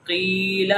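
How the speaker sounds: قیل with a long held ee; long vowel. Shown as a demonstration. Teacher drills ya madda, قیل drawn out. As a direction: stretch the middle of قیل. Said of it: "ہم اس کو لمبا کر رہے".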